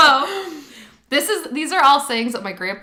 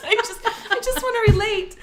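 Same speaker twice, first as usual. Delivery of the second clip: laughing; 8 semitones higher than usual. Real, not acted. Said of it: "I'm laughing way too hard".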